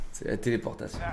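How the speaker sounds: breathless whispered aside; whispered disbelief. Real, not acted. Streamer muttering to himself about a teleporting enemy.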